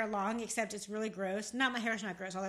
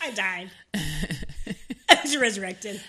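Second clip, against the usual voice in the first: laughing; 2 semitones lower, 7 dB louder, much slower than usual. Real, not acted. Laughing after choking fit.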